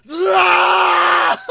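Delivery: high, loud rage yell; furious roar. Retold or imitated. Roaring at the fleeing kid in rage. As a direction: let out a raging scream. Enraged after fight.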